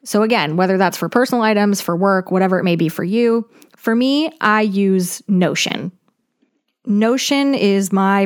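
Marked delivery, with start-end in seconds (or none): none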